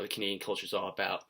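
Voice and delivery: normal talking voice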